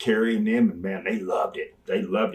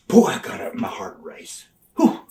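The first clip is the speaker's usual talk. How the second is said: breathless recall; 7 semitones higher, 5 dB louder than usual. Real, not acted. Recalling pre-match adrenaline.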